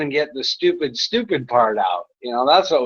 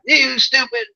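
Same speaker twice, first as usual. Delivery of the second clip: high shouted troll jeer; 11 semitones higher than usual. Retold or imitated. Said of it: "So he comes back".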